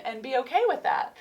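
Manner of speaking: ordinary talk, nothing marked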